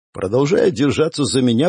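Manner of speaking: ordinary delivery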